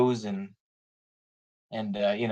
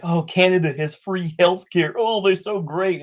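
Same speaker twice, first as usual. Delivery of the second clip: higher-pitched mock enthusiasm; mock gushing; 6 semitones higher, 3 dB louder than usual. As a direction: parody a starry-eyed admirer. Mocking naive fans of Canada.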